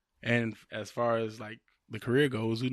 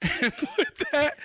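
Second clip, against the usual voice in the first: high, laughing through the line; cracking up mid-speech; breaking into laughter; 14 semitones higher than usual. Real, not acted. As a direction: lose character in real giggles.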